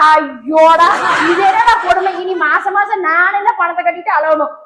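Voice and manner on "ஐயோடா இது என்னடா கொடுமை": laughing dismay. Acted. Laughing at the absurd loan.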